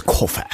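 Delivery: mocking "coffee" with an "air" ending; affected posh coffee. Co-host queries coach's pronunciation, affected posh "coffee". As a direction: parody British toff saying "coffee". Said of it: "not even a Lord of the Manor says coffee".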